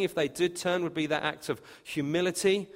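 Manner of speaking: normal talking voice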